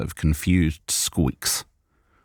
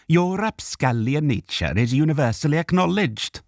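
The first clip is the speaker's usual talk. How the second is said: indignant Welsh elf; 9 semitones higher than usual. Acted. Elf voiced.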